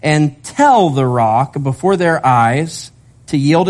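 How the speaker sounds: "tell" held long with a rising lift; slow reading with "tell" drawn out and rising; stress on tell. Shown as a demonstration. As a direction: lean hard on the word "tell", slowly. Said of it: "underline that word perhaps".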